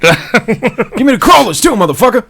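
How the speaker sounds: high, loud hammed-up robber, laughing; playing a robber at the donut shop; mock robber. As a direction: demand donuts like a cartoon stickup.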